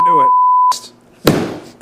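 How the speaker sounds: high, long drawn-out "I knew it"; stretched-out gleeful cry; triumphant squeal. Real, not acted. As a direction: crow in delight at catching the fly. Sudden high exclaim at the fly.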